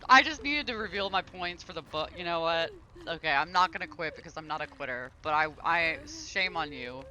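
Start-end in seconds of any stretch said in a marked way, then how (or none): none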